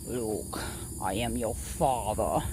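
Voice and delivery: low, quiet menacing villain; Darth Vader. Acted. Playing with flashlight as a Star Wars lightsaber, low menace. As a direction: rumble it like a masked villain. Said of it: "totally go Star Wars".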